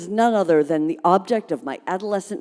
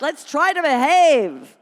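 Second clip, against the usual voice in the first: high, louder and slow; pleading; 9 semitones higher, 5 dB louder, slower than usual. Acted. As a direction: plead with the room to behave. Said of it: "she begged".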